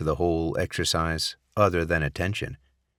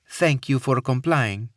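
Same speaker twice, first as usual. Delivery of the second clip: put-on Middle Eastern accent; 7 semitones higher, 3 dB louder than usual. A narrator's reading. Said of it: "tinged with a Middle Eastern accent".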